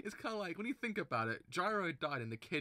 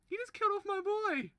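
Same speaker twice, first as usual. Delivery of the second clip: much higher, strained shriek, stress on "killed"; even more pained squeal; squealing outrage; 8 dB louder than usual. Real, not acted. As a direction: shriek it, voice cracking upward.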